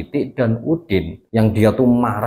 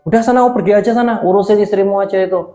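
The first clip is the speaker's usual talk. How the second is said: fast, higher angry outburst; angry father; 8 semitones higher, 4 dB louder, faster than usual. Acted. Father chasing Udin out of the house, angry. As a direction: throw the son out in a rush. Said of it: "kata ayahnya marah juga".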